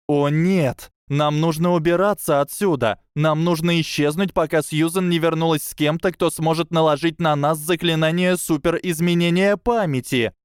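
panicked. A narrator's reading. Tracey panicking to the girls, urgent and high. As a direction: urge them all to run.

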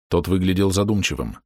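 ordinary delivery

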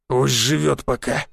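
slow, growling grudging threat. A narrator's reading. Thug backing down before the crowd, growling slowly. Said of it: "услышал я рык амбала".

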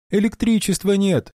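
very high frantic cry; desperate cry. Acted. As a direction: shout it in panic. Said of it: "в отчаянии вскрикнула Коралл".

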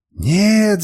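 high, loud cry of protest; screamed refusal. A narrator's reading. Woman refuses to be given to slave, screamed protest. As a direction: cry out in alarm, pitch jumping up. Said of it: "закричала она".

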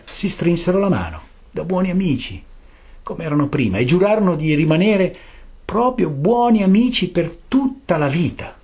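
ordinary delivery, nothing marked

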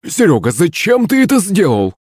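high, indignant scolding; spiteful frog outburst. A narrator's reading. Frog to the men, angrily indignant. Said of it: "злобно вскрикнула лягушка".

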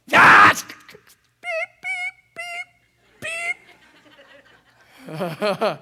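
shrieked, sung-out bleeps imitating a cuss; high yelp then bleep notes like a censor tone; mock swearing outburst.

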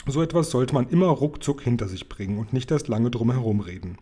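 usual speaking voice

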